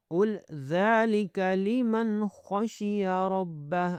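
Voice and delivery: ذالک stretched on "dhaa"; slow tajweed reading, heavy ra in ربہ; elongated, heavy-letter recitation. Shown as a demonstration. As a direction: chant slowly, خشی with a thick khaa. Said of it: "زال کو کھینچنا ہے".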